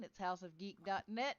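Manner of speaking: ordinary talk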